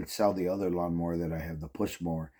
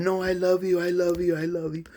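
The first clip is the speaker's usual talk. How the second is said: high, whiny imitation of her crying; hammed-up tearful pleading from the girlfriend; mock crying plea; 10 semitones higher than usual. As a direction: mimic her begging you to stay, fading off. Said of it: "she started her crying".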